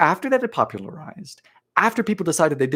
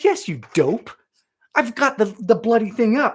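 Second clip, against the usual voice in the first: louder, higher indignant retort; mock exasperation; 8 semitones higher, 6 dB louder than usual. Streamer answering YouTube's prompt, mock exasperated. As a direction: snap back in mock annoyance. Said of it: "I said".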